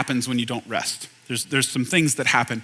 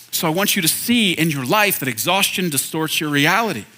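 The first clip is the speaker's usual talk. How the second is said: ordinary talk, nothing marked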